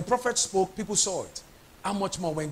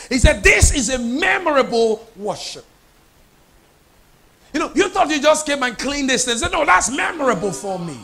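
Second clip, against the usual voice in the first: loud, raised-pitch preacher delivery; impassioned preaching; 3 semitones higher, 6 dB louder than usual. Real, not acted. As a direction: drive it home to the congregation at full voice. Preaches at full force.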